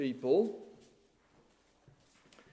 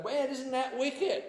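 drawn-out, higher mock teen voice; slangy youth; 8 semitones higher, slower than usual. Preacher mocking young people's slang, high and drawn out. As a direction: send up the worldly young people's slang, slowly. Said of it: "go around saying".

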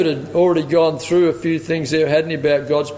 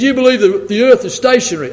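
high, loud disbelieving question; can't believe what you hear; imitated scoffing atheist; incredulous; 7 semitones higher, 6 dB louder than usual.

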